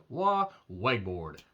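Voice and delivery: Weg board said slowly, one syllable; slow mispronunciation. Shown as a demonstration. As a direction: drag out Wah, Weg board. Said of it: "Nailed it".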